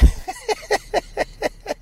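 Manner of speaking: high wheezy giggle; real high-pitched laughing. Real, not acted. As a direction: laugh helplessly at your own line. Laughing at his own story.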